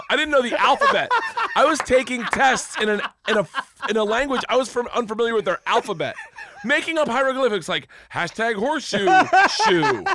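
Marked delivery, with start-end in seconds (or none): 0.1-4.9 laughing high through the words; can't keep a straight face telling it. Real, not acted. Telling his friend about Russian class, laughing. 6.6-10.0 climbing higher, ending on a squeaky shoe; giddy. Real, not acted. Joking to his friend about fake letters, rising giddy. Jokes about invented alphabet.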